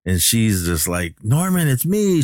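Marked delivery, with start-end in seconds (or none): 1.2-2.2 high-pitched imitation of a woman pleading; acted-out plea for recognition; mock pleading. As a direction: pitch it way up, imploring.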